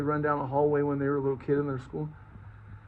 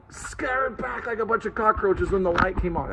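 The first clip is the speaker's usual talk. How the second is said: normal talking voice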